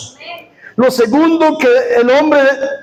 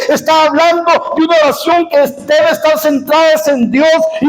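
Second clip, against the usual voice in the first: fiery preaching; 5 semitones higher than usual. Acted. Preaching at full force.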